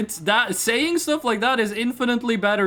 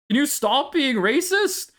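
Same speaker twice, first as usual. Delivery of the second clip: normal talking voice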